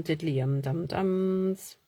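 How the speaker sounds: ordinary talk, nothing marked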